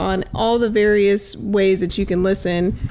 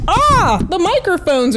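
sudden loud startled gasp; 9 semitones higher, 4 dB louder than usual. Real, not acted. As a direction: caught off guard as the mic shifts. Microphones suddenly start moving.

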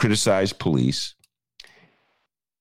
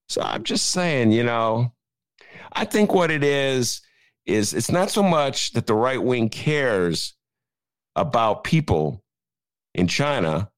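ordinary delivery

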